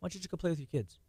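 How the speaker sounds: soft, quick wife's suggestion; gentle wife. Retold or imitated. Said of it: "She goes".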